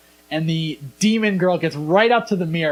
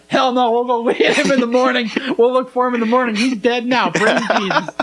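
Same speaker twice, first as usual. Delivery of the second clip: high-pitched, hammed-up sassy refusal; comic dismissive old-lady impression, pitched up; Medea; 6 semitones higher than usual. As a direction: imitate a brassy movie matriarch waving it off. Acted Medea refusing to help.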